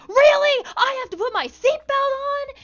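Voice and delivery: mock her outrage at the request; indignant angry rider. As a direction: passenger outraged at buckling up, loud shrill disbelief. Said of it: "she got so fucking angry at me".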